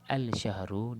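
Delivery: "Al-Syahru" with the L fully pronounced; qamariyah-style lam. Shown as a demonstration. Teacher explaining the mistake, L sounded in "Al-Syahru". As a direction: voice a distinct L in "Al-Syahru". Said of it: "dia jadi alif lam qamariyah".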